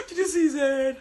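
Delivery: high, loud, laughing it out; laughing disbelief. Retold or imitated. Recapping the fight for viewers, laughing loudly. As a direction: cackle the question in disbelief. Reenacting the housemates' reaction.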